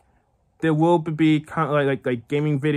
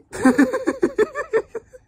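shrieking high laughter. Real, not acted. Laughing at own impression.

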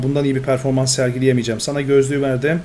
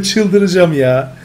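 slow, louder exasperated moan; exasperated groan; 3 semitones higher, 6 dB louder, much slower than usual. Real, not acted. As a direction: drag it out in frustration. Frustrated with time limit.

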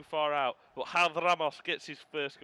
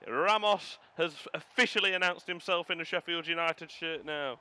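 loud, raised, elated praise; elated commentary; 4 semitones higher, 5 dB louder than usual. Real, not acted. Gamer praising pressing after third goal, elated. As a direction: ride the high of the goal.

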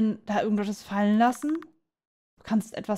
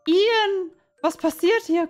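high-pitched distressed calling out; acted alarm; 9 semitones higher, 4 dB louder, slower than usual. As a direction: demand answers as a confused captive. Captive June confronts Ian.

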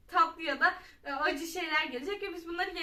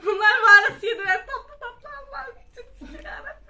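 high laughing groan; laughing frustration; 5 semitones higher, 12 dB louder than usual. Real, not acted.

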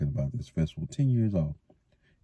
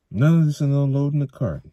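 quick, louder, businesslike; brisk; 10 semitones higher, 6 dB louder than usual. A narrator's reading. Tam urging everyone back to work, brisk. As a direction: get things moving. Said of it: "Tam said briskly".

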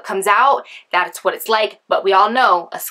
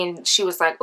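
usual speaking voice

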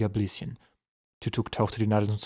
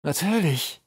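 higher, drawn word with menace; threatening; 7 semitones higher, slower than usual. A narrator's reading. General realises the troll's use, threatening. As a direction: let it dawn with a menacing edge. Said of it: "Seine Stimme klang drohend".

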